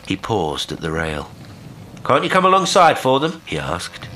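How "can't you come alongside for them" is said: high, loud hailed question; raised voice carrying over water; calling out. A narrator's reading. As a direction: shout the question over the side. Will hails the unseen boatman.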